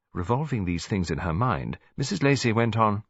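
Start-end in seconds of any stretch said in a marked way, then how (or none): none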